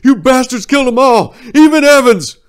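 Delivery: very high, very loud grief-fuelled accusation; enraged scream. Acted. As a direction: hurl the loss at him. Soldier screams at enemy.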